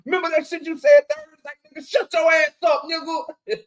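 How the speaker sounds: high-pitched mock-angry scolding; D one. Imitating a streamer mad over something from streams ago, mock angry. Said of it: "D one be mad at Boxer Hacker".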